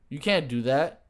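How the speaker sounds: normal talking voice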